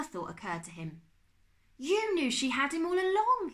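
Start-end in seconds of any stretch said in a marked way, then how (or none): 1.8-3.5 high, loud shocked gasp; a boy suddenly realising his uncle knew; gasped realisation. Acted. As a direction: blurt it out as it dawns on him. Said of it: "he gasped".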